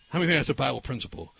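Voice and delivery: normal talking voice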